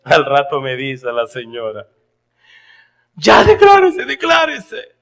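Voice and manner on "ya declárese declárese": very high, loud insistent lady. Retold or imitated. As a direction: urge him to confess, pitched high. (señora insistente, voz muy aguda y fuerte)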